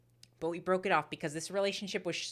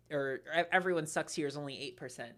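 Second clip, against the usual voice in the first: ordinary delivery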